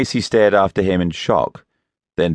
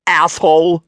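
angry outburst; 12 semitones higher, 6 dB louder than usual. A narrator's reading. Insulted by her boss.

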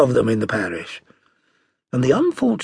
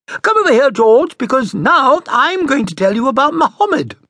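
put-on high, louder call to the child; mother summoning George for a lesson, high and loud; 13 semitones higher, 7 dB louder than usual. A narrator's reading. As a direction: call the boy over, bright and high. Mother calls the boy over.

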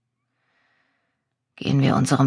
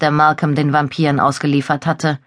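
usual speaking voice

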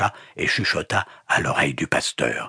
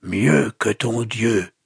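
slow, higher, effortful defiance; rasped out; 5 semitones higher, much slower than usual. A narrator's reading. Wounded captive defying the pastor, rasping slowly. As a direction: drag the words out painfully. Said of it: "souffla-t-il dans un râle".

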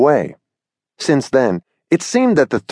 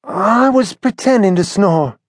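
a touch of indignation in high Billy voice; mildly offended young bird; Billy defending himself, mildly indignant and high-voiced; 9 semitones higher than usual. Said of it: "said Billy indignantly".

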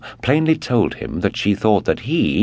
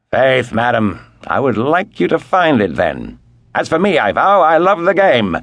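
hearty, loud and high Englishman; jovial loud voice; 8 semitones higher, 4 dB louder than usual. Acted. Lord Antony telling the Comtesse, jovial and loud. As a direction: boom it out like a cheerful sportsman. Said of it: "jovial, loud, and pleasant voice".

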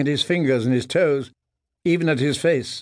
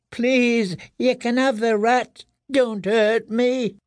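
high, drawn-out pleading whimper; frightened begging; 10 semitones higher, slower than usual. A narrator's reading. Prisoner offering the boys his rat, frightened begging. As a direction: beg in a thin high voice, slowing to a wail. Prisoner begs not to be hurt.

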